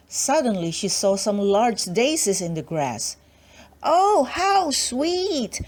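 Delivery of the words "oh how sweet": high, very slow gasp of delight; delighted exclamation. Acted. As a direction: swoon over the daisies. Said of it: "she exclaimed".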